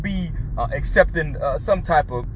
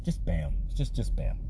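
ordinary talk, nothing marked

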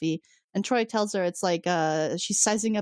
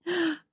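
sharp gasp; 9 semitones higher than usual. Real, not acted. Shocked reaction to the line.

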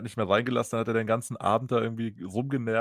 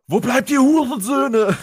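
much higher, louder, slow mock rant; imitated furious scream; 13 semitones higher, 11 dB louder than usual. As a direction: scream it at the dispatcher like a drunk. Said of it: "schreit einfach nur".

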